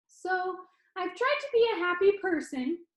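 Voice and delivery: perky showman pitching to his audience; cheerful Danny. Acted. Danny on video teasing the funeral crowd, bright and upbeat.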